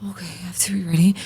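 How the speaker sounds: quick unvoiced mutter to herself; whispered resignation. Answering the sleep app in a resigned quick whisper. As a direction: whisper it fast, giving in.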